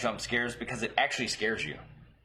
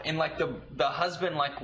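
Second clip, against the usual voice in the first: ordinary talk, nothing marked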